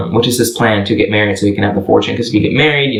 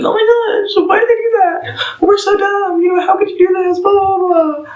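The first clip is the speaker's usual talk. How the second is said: much higher, squeaky panicked voice; panicking kids. Acted. The orphans panicking after the signing, squeaky high panic. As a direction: squeal it like frantic little kids.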